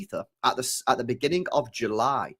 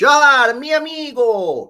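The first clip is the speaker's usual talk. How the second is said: high, loud, slow drawn-out charmer voice; mocking suave charm; 9 semitones higher, 9 dB louder, slower than usual. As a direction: ham up a smooth foreign charmer. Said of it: "seduced Turkey with his horrible French".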